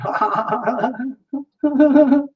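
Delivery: long, high-pitched peal of laughter. Real, not acted. Card reader amused while greeting viewers, high laughter.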